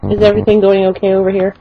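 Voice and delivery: low, quick checking-in voice of the clerk; imitated cashier. Cashier comes over to check on things, low imitated query. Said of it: "she comes over and she goes".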